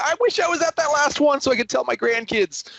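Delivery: high, wistful mock regret; imagine telling the grandkids, sighing; wistful grandparent voice. Mock regretful future self.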